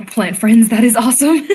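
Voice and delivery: loud, high excited cheer; thrilled loud exclamation. Real, not acted. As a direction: shout it out with delight.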